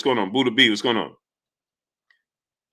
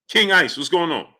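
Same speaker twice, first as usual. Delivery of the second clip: usual speaking voice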